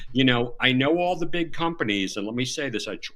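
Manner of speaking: normal talking voice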